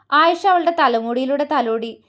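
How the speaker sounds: ordinary delivery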